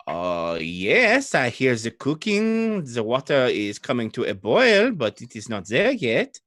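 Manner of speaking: put-on French-accented woman at her cooking pot. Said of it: "French in this case would be Old Adari".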